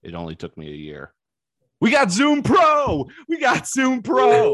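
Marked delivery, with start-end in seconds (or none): 1.8-4.5 big slow high announcer shout; hype shout. Acted. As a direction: belt it out like a hype man. Hyped announcement of new gear.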